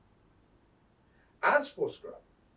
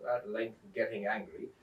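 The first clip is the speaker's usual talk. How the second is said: usual speaking voice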